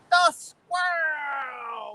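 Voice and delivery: long slow called-out greeting; hollered viewer shoutout; drawn-out shout. Real, not acted. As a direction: stretch the name out like calling across the lake.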